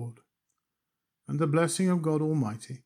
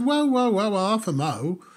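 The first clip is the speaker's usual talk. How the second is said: flag him down before he sells you more; mock alarmed interruption; 6 semitones higher than usual. Customer cutting off the shopman's sales pitch, alarmed.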